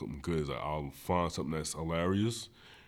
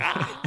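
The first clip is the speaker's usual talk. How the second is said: mock shriek of laughter; 12 semitones higher, 5 dB louder than usual. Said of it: "And I'm just like".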